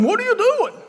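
high-pitched mock donkey protest; talking donkey. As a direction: squeal the question like an indignant donkey. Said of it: "and then the donkey goes".

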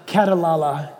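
katalala repeated through a laugh; amused katalala. Shown as a demonstration. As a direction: laugh while saying katalala again. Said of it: "I'll say it slowly".

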